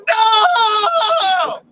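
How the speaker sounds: high, stretched-out howl of loss; hammed-up wail of utter defeat; bitter cry. Gambler losing the bet on the last shot, hammed-up anguished cry. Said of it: "cried with a great and exceeding bitter cry".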